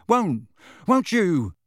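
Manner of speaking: high, slow, hesitant question; falter, then ask for reassurance; uncertain. A narrator's reading. Boy checking with his friend Harry, uncertain and hesitant. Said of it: "he added uncertainly".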